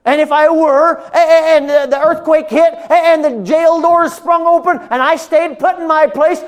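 high, loud fervent sermon; full-force preaching. Real, not acted. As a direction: preach it out to the back row.

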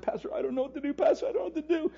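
high, strained helpless cry; distraught pleading. Retold or imitated. Clay pacing and pleading with his pastor, high distraught cry. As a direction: voice of a man at his wits' end.

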